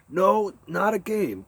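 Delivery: desperate cry. Acted. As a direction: insist it's no game, pleading. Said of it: "cried the captain".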